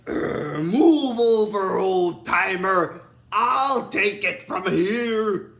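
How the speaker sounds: very slow, loud villain impression; Zed. Imitating Zed taking over from Vile, slow and domineering. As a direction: draw out every word in a commanding villain voice. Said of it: "Zed's like".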